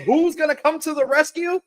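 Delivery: high, fast, hyped-up; hype announcer. Acted. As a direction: sell it like an entrance. Hyping Shane McMahon entrance.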